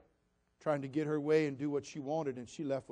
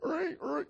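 two short, very high squeals; joke squeal; 12 semitones higher than usual. Pretend. Preacher jokingly answering a critic, mock squealing. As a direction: squeal twice in mock reply. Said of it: "to that I very kindly said".

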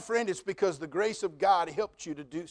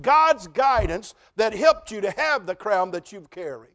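louder, higher, with weight on each "God's"; preaching at full force; 4 semitones higher, 6 dB louder than usual. Acted. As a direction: build the list to a fervent climax. Preaching climax.

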